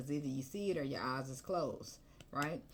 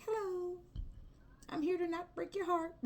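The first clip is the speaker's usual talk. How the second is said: high, lightly sing-song greeting; mock sweet newcomer. New lover greeting a jaded ex, high and mock-sweet. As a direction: play the too-eager new lover.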